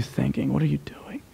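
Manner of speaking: whispered, breathless plea to God. Retold or imitated. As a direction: drop to a desperate whisper. Voicing our despairing cry to God.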